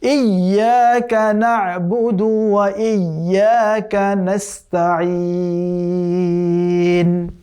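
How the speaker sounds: slow, melodic tajweed chant drawn out at the end; chanted Quranic recitation. Shown as a demonstration. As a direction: recite the verse once, slowly, with full tajweed. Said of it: "আমি আবারও পড়ছি পুরোটা একবার".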